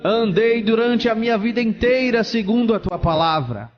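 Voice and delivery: desperate shouting. Acted. Condemned pastor pleads before God's throne, shouting. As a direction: cry out, pleading your case. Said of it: "ele começou a gritar".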